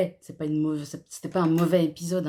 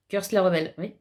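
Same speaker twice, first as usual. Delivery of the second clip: ordinary talk, nothing marked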